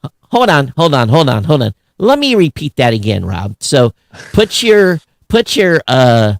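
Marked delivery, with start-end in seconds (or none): none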